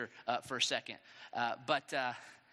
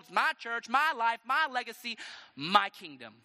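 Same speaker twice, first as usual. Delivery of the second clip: raised, forceful grasping rant; possessive heart; 5 semitones higher, 5 dB louder than usual. Acted. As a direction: claim everything as yours, hammering each MY. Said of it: "The heart that says".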